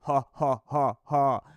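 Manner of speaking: loud mock evil laugh; hammed-up evil laugh; villain laugh. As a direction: cackle it like a cartoon villain. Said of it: "says Comrade Putin".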